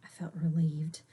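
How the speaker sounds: breathy whisper of relief. A narrator's reading. Relief after warning.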